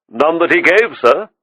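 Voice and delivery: polite high waiter voice; a deferential waiter offering a gift. A narrator's reading. Waiter answering Jordan.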